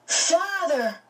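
high excited yell. A narrator's reading. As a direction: shout across the lawn. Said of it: "Sandy suddenly yelled".

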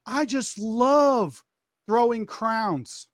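retold dreamy enthusiasm, stretching the words; gushing kid. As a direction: imitate a kid savoring what they love. Retelling a kid's enthusiastic line.

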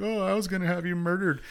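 high-pitched pretend weepy confession; playful fake-crying voice; mock crying. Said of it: "then he starts crying".

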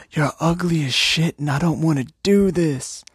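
high, loud whine of protest; mock wailing. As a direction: blurt it out like a bawling groom, hammed up. Voices the wailing, horrified groom.

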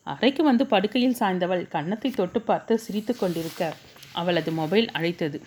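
usual speaking voice

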